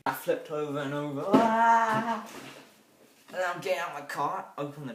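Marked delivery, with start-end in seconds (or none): 1.3-2.2 yelp. Acted. Acting out the car flip.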